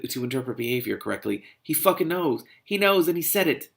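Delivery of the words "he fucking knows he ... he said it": higher, emphatic exasperation; angry insistence. Real, not acted. Reader condemns the character's excuse, angrily insistent. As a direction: insist on it, fed up.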